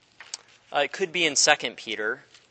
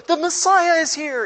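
high, loud crowd shouting the news; excited crowd cry; 14 semitones higher, 6 dB louder than usual. Retold or imitated. Crowd hailing Jesus after a healing, high excited shout. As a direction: cheer it like an amazed crowd. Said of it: "everybody goes".

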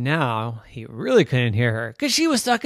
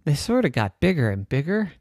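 usual speaking voice

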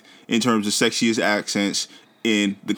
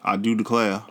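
Southern drawl. Retold or imitated. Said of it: "the Texan accent".

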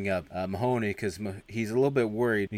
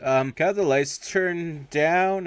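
strained, drawn-out, higher-pitched request; trying to talk normal; 7 semitones higher, 5 dB louder, slower than usual. Retold or imitated. Flustered speaker to his audience, trying to talk normal. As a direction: stretch it out, struggling to sound composed. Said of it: "he's trying to talk normal".

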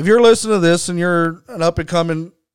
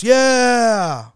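mock crowd yell, higher; crowd cheer; 6 semitones higher than usual. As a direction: imitate the whole house shouting back. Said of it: "everybody in the house".

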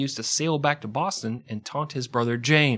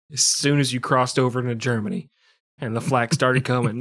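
ordinary delivery

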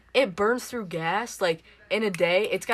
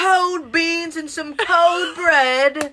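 slow, high British caricature; mock British accent; 7 semitones higher, 4 dB louder, slower than usual. Teasing British food with a friend, mock British accent. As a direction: drag out a hammed-up British accent. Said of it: "go off on the British people".

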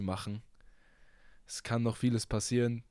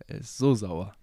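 louder comic complaint; exaggerated whine; 6 dB louder than usual. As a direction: ham up the sourness. Repeats joke, exaggerated.

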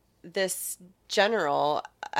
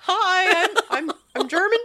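high, loud, stammering with laughter; hammed-up panicky lie, tripping over the words; nervous bluff; 12 semitones higher, 7 dB louder than usual. Spy who speaks no German tells captors he's German, nervous bluff.

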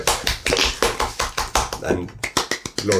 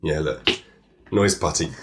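normal talking voice

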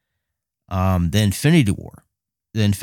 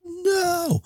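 anguished no; 3 dB quieter than usual. Retold or imitated. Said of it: "I was like".

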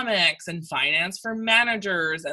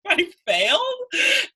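high, slow words broken by laughter; laughing through it; 14 semitones higher, slower than usual. Real, not acted. Telling friend about failing, laughing. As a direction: laugh it out in disbelief, drawing out failed.

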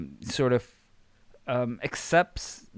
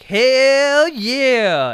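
high, loud, drawn-out mock yell; mimicked cheer; 14 semitones higher, 11 dB louder than usual. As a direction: holler it back like an overeager lone fan. Mimics Byron's yell back to Austin.

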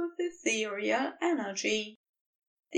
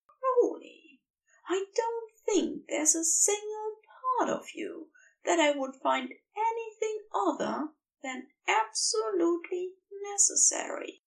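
higher-voiced angel, half-whispered and tender; Aziraphale's tender confession; 9 semitones higher than usual. Aziraphale confessing his love to Crowley, higher, hushed and tender. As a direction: breathe the confession to him as the angel.

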